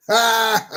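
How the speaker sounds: single high bark of a laugh; a quick happy "ha"; delighted laugh. Real, not acted. Tickled by the bee coin, a sharp laugh.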